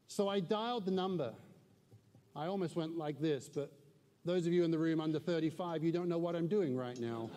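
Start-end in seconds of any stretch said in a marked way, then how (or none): none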